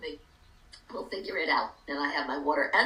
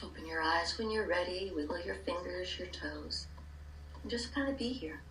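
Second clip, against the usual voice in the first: calm guided meditation, hushed; 3 semitones lower, 4 dB quieter than usual. Acted.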